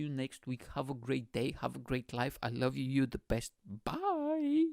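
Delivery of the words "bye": very high, drawn-out goodbye; squeaky farewell. Pretend. Host signing off to podcast listeners, playful high squeak.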